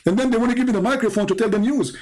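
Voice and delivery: usual speaking voice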